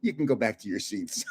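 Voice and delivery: a low, quiet verdict from the guard; stern guard. Retold or imitated. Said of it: "He goes to me".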